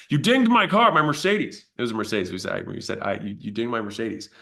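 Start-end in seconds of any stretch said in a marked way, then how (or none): 0.1-1.7 much higher and louder, outraged; hammed-up fury over the car; mock outraged caller. Caller scolding the gym owner over his Mercedes, mock outrage. Angry caller complaining about flyer.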